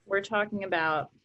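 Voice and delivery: normal talking voice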